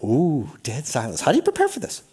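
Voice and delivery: ordinary talk, nothing marked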